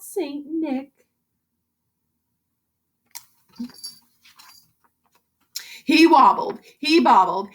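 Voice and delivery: put-on lively rhyming read-aloud for kids; story narration. A narrator's reading. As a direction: read it like a bouncy bedtime rhyme. Teacher reads picture book aloud.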